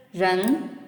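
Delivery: usual speaking voice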